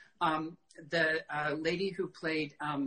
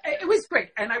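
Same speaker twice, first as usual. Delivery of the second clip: much higher, louder burst of pride; excited; 8 semitones higher, 7 dB louder than usual. Real, not acted. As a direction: it was great, bursting with pride.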